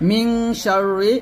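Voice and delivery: মিং drawn out slowly with a thick hum; heavy nasal ghunnah. Shown as a demonstration. Teacher demonstrating thick ghunnah, heavy drawn-out nasal. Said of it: "কীভাবে গণনাটা মোটা হবে? এরকম দেখুন".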